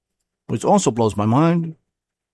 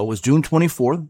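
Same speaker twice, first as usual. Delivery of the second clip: usual speaking voice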